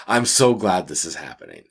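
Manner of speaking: ordinary talk, nothing marked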